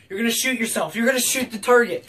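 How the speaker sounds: ordinary talk, nothing marked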